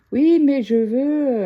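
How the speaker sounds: imitating a stubborn dad, loud, high, drawn-out; insistance butée imitée. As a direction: drag out the protest, high and loud. Retold argument, father insists stubbornly. (imitation d'un père têtu qui insiste, fort et traînant)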